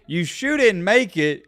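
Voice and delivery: usual speaking voice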